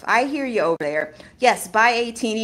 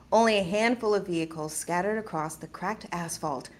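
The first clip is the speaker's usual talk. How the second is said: measured, softer reading voice; story narration; 2 semitones lower, 6 dB quieter than usual. A narrator's reading. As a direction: narrate steadily and evenly. Starts reading the story aloud.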